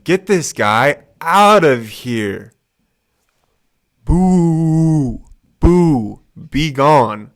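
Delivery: loud, slow jeering boos; booing crowd. Retold or imitated. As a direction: shout him off the stage. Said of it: "People are saying".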